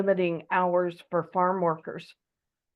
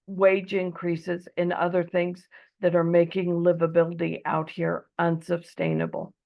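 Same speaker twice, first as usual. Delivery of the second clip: ordinary talk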